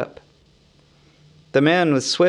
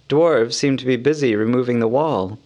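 usual speaking voice

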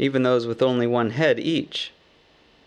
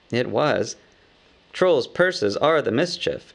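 plain reading voice

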